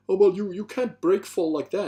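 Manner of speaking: high, loud know-it-all objection; mocking Aikido guy. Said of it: "There's an Aikido guy who was like".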